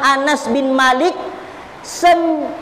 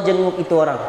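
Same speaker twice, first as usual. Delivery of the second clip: ordinary talk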